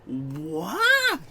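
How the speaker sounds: imitating a puzzled reaction; baffled what. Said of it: "just gave me a big".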